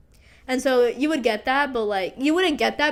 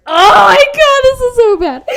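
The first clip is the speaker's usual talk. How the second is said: embarrassed squeal; 13 semitones higher, 15 dB louder than usual. Real, not acted. Cringing at her own story.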